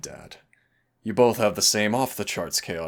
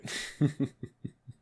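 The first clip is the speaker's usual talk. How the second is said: laugh; 8 semitones higher than usual. Real, not acted. Amused at partner's reaction.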